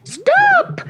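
very high loud shriek. Acted. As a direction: shriek to stop them. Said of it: "shrieked the witch".

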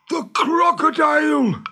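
high, loud, drawn-out panic; terrified gasp. Acted. Hook hears the crocodile coming, terrified cry. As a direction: cry out in sudden high-pitched dread. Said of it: "gasped Hook".

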